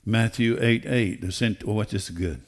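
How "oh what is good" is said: ordinary delivery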